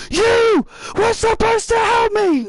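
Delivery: high, loud furious complaint; angry wrestler voice. Acted. Chad yelling at his team, mock angry. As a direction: yell like a betrayed coach.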